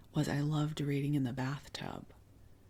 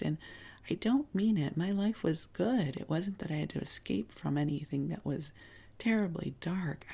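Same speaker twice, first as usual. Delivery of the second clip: normal talking voice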